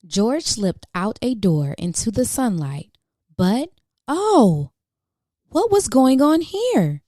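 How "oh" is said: usual speaking voice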